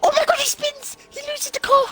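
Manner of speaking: very high-pitched real shock; shocked outcry. Real, not acted. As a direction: cry out at the sudden spin. Shock at a live spin.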